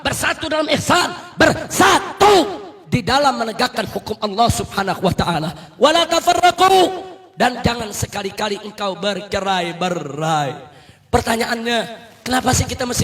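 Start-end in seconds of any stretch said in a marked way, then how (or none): 0.1-6.9 loud, chanted sermon cry; fiery preaching. Acted. Preacher calling the crowd to unity, fiery preaching. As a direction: preach it out to the crowd with full force.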